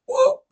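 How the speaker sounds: high, loud whoop sound effect; a quick squealing whoop as the baby lands. Retold or imitated. Doctor catching the newborn, high whoop noise.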